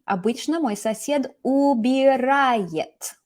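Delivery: "убирает" drawn out syllable by syllable; correct-answer demo. Shown as a demonstration. Teacher giving the correct version, "убирает" slowly sounded out. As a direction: stretch "убирает" slowly, each syllable separate. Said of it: "the correct version would be".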